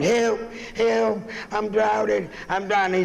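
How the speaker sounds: high mock cry for help; drowning man. Man in the river calling up to the bridge, high mock panic.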